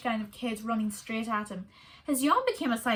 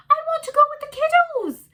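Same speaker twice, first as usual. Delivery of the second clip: high, loud squeaky meerkat; a chirpy little meerkat answering his love; 11 dB louder than usual. A narrator's reading. Meerkat Kevin to his wife, high and eager.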